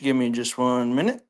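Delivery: normal talking voice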